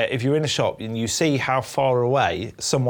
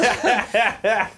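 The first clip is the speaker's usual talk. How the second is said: laughing; 8 semitones higher than usual. Real, not acted. Laughing at own joke.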